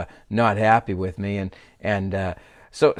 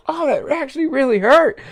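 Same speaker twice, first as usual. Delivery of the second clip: pained high cry; 7 dB louder than usual. Retold or imitated. After punch in nose.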